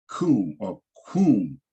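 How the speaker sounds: kum drawn out slowly, said twice; slow sounding out. Shown as a demonstration. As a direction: stretch each kum for learners. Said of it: "sounds out the parent root".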